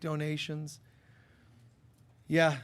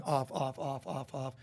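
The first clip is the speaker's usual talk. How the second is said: fading repetition; 3 semitones lower, 5 dB quieter than usual. Real, not acted. Repeating word fading away.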